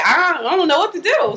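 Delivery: acted touch of panic; going crazy. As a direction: play a flustered mother at a loss. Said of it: "she going crazy".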